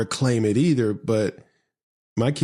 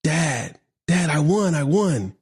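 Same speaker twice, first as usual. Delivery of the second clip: retold child's excited cry, pitched up; 7 semitones higher, slower than usual. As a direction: call out proudly as an excited little girl. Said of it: "she's all excited. She's like".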